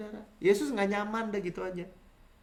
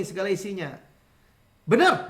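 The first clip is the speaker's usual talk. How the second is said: ordinary talk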